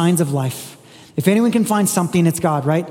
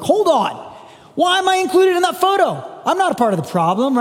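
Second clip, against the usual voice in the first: much higher, startled mock objection; imitated listener, caught off guard and higher; indignant protest; 9 semitones higher than usual. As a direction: squeak out a "who, me?" protest. Listener protesting inclusion in photo.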